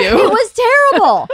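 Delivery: high, loud laugh through the words. Real, not acted. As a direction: laugh out a slow "terrible". Laughing complaint about lost account.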